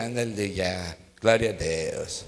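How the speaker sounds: "Aleluia, glória a Deus" grave, baixo e lento, sem vontade; faça o pior louvor possível, bem mais grave e mais baixo; louvor péssimo. Shown as a demonstration. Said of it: "Faz um louvor péssimo". (louvor de má vontade para a pessoa ao lado, grave e baixinho)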